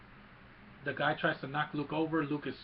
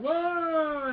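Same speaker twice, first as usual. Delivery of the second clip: high, loud, long "whoa"; imitated falling scream; 14 semitones higher, 9 dB louder than usual. Retelling the fall out of the net, mock scream. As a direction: wail as the floor drops away. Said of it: "C three P O is like".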